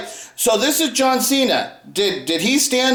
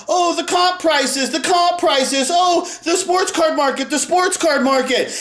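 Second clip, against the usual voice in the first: higher, slow whiny complaint; mocking Neo's whiny voice, slow and higher; mock whining; 5 semitones higher than usual. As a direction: whine it like a crybaby. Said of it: "Neo complaining about".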